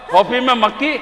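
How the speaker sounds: outraged. Acted. [name] repeats with outrage.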